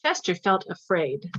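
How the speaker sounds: ordinary delivery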